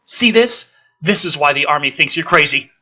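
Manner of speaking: normal talking voice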